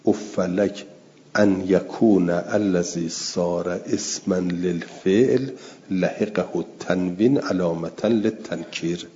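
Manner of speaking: normal talking voice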